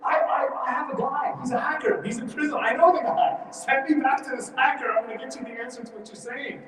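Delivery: high, eager, pushy voice; hammed-up eager servant selling his contact; excited recall. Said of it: "He's like".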